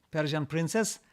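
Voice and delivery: usual speaking voice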